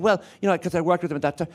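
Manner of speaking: put-on chatty small-town Irish accent; changed home accent. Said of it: "everything just changed completely".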